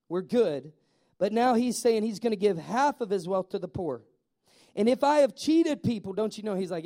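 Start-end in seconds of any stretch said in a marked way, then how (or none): 4.7-6.1 higher and louder, pleading admission; a tax man owning up to the crowd, louder; earnest confession. Pretend. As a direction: confess it out loud. Zacchaeus confessing.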